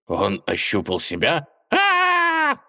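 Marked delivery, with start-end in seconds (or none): 1.7-2.6 high squeaky shriek of horror; squeaky gasp. Acted. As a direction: shriek in rodent panic. Gopher realizes.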